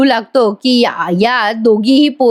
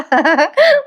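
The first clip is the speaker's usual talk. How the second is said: high, short giggle; brief gleeful giggle; amused laugh; 6 semitones higher than usual. Real, not acted. Narrator looking forward to tomorrow's episode, short giggle.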